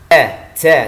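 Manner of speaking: "Ja, ja" loud, with no air let out; jahr jim. Shown as a demonstration. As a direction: punch out "ja" with the breath held. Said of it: "tidak ada udara yang keluar". ("ja" keras, nafas tertahan)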